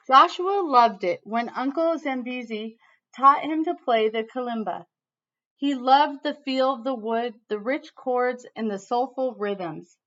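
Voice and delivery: lively read-aloud storytelling voice; reading to children. Acted. Teacher reading a picture book to her class, lively storytelling.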